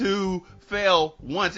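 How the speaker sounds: ordinary delivery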